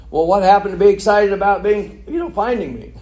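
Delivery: high, loud, incredulous question; mock puzzled. Jesus to the complaining crowd, imitated as mock puzzled. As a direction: tease them with exaggerated bewilderment.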